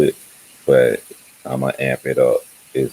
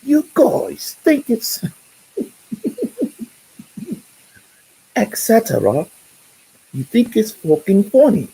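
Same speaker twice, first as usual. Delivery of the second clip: much higher, slow mock posh British voice; mock British accent. Friend Stephanie reading his rant aloud, in a mock British accent. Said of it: "she read them in a British accent".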